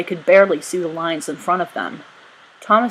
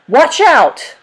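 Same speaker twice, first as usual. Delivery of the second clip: high, loud warning yell; 10 semitones higher, 7 dB louder than usual. A narrator's reading. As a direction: shout a warning across the deck. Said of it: "Ratcliffe yelled".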